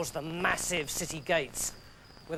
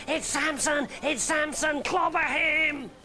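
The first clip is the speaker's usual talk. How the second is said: higher, rowdy crowd cry; rally the mob; higher mock-angry crowd yell; Philistines shouting; 9 semitones higher, slower than usual.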